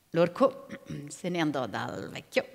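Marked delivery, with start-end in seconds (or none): none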